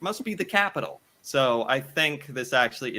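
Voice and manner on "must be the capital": high, quick confident guess; clueless American. Retold or imitated. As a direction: toss off a confident wrong guess. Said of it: "They'd be like".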